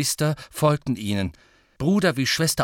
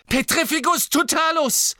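very high, desperate incantation; shouted spell; 3 dB louder than usual. A narrator's reading. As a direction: hurl the spell out in a cry. Spell cast while attacked.

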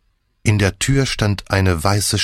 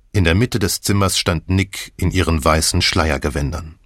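plain reading voice

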